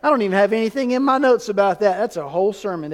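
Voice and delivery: ordinary talk, nothing marked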